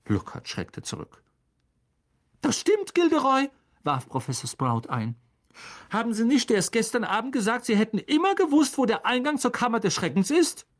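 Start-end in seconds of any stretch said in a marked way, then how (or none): 2.4-10.7 much higher, fast taunting voice; witch-teacher goading a fraud; high mocking Sprout. A narrator's reading. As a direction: needle him sweetly.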